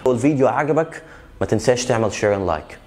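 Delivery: ordinary talk, nothing marked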